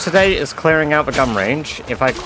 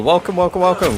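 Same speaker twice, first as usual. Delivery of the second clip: excited welcome; 7 semitones higher, 3 dB louder, faster than usual. Real, not acted. Greeting a viewer.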